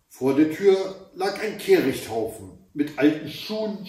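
usual speaking voice

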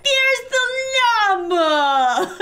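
delighted laugh at the llama. Real, not acted. Player seeing her llama arrive, laughing delight.

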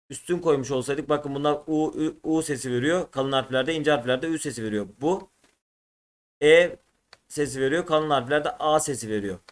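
normal talking voice

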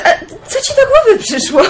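high, laughing what-are-you-up-to; amused surprise. Acted. Basia asks her visiting friend what she's thinking, in amused surprise.

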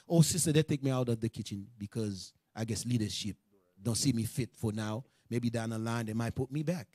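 very low, soft, accepting answer; acted humble resignation, quiet and low; humble spirit. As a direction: answer meekly, voice dropped right down. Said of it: "That's a humble spirit".

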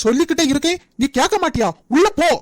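husband yelling at his wife to go in; angry order. Acted. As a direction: bark her back into the house. Jeevan orders Janani inside.

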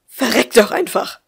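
loud, high hateful outburst; raging curse. A narrator's reading. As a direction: hurl it at her in fury. Mother raging at daughter. (hasserfüllt geschrien)

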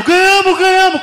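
high, loud triumphant yell; imitated winner's shouting. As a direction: scream it like you've just won.

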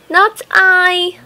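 high mouse refusing. Acted. Mouse answers hen.

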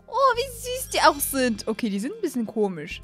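ordinary talk, nothing marked

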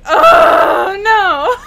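loud high cry of dismay; shocked shout. Retold or imitated. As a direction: yell it in mock horror. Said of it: "and I was like".

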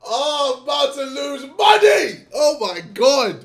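laughing shout. Real, not acted. Laughing outburst at the clip.

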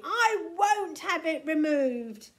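stubborn angry outburst; screamed refusal. A narrator's reading. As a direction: shout it defiantly, leaning on each word. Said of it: "screamed Tiny".

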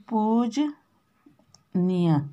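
पूजनीय stretched very slowly; slow dictation. Shown as a demonstration. Teacher repeats पूजनीय slowly while writing it. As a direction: drag out पूजनीय syllable by syllable. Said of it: "इसको repeat लिखती हूँ".

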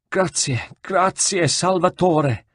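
high, grateful, weeping thanks; cling and thank your rescuer; tearful thanks. Acted. Rescued monk thanks Ezio through tears.

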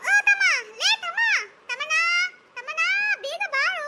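high-pitched calling out; sing-out call to someone out of sight; yelling. Acted. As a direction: call little brother over to play. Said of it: "ಏನಕ್ಕ ನೀನು ಬೊಬ್ಬೆ ಹೊಡಿತೀಯ".

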